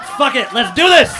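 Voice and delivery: loud, high pumped-up yell; mock battle cry. Comic recalling his decision, shouting it out. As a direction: psych yourself up with a shout.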